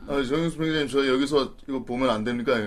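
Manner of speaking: soft, low, timid request; meek junior soldier. Acted. Junior asking the sergeant to let him watch, meek voice. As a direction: plead politely with a superior.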